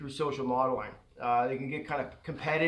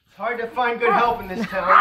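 joking through a laugh; teasing the helper through laughter; 4 semitones higher, 6 dB louder than usual. Real, not acted. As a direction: tease while laughing.

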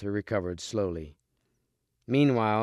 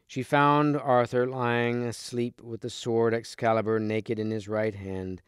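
ordinary delivery

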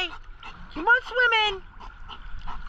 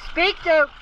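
ordinary talk

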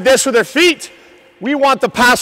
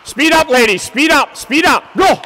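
shouting; 6 semitones higher, 5 dB louder than usual. Real, not acted. Coach yelling at skaters.

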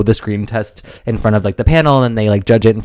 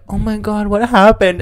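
high-pitched imitated gasping sympathy; mock concern; 10 semitones higher than usual. Girls in the green room to sobbing contestant, mock concern. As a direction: put on a high voice of shocked friends.

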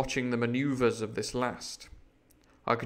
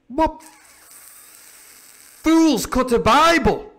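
much higher, louder outraged pirate; 12 semitones higher, 11 dB louder, much slower than usual. Acted. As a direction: spit out the shock at the sacrilege.

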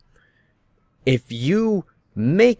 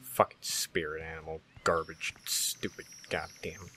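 grumbling under breath; 5 semitones lower, 10 dB quieter than usual. Real, not acted. Grumbling after walking off.

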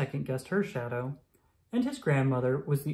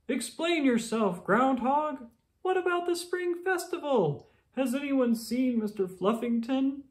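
high, indignant demand; demanding townsperson; 10 semitones higher than usual. Acted. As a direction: confront him, upset. Townsperson confronts Groundhog.

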